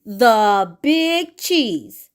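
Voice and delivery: very slow, stretched proud announcement; drawn-out grand title. Shown as a demonstration. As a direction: draw out every word like a royal title. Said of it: "say it with me".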